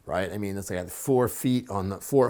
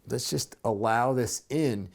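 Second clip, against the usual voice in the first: ordinary delivery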